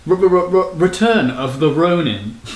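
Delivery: slow drawn-out return of the Ronin in a British accent; British accent hype intro. Shown as a demonstration. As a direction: stutter the R then stretch return, British accent. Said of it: "it's actually in a British accent".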